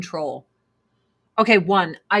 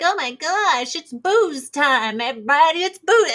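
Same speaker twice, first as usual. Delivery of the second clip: giddy mock drunk announcing booze; mock party drunk; 5 semitones higher than usual. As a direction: ham up a tipsy cheerleader. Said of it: "they're always like".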